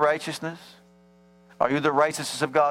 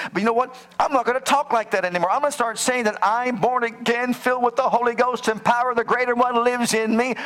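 pitched up and louder, triumphant; triumphant declaration; 8 semitones higher, 3 dB louder than usual. Acted. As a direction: proclaim it like a victory. Acted believer declaring faith.

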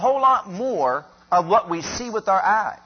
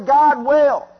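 high, loud call for the crowd to echo; 7 semitones higher, 9 dB louder than usual. Acted. Preacher leading the congregation, loud call to repeat. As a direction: lead the church in a shout.

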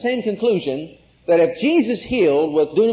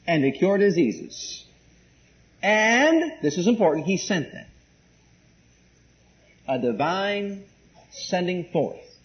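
usual speaking voice

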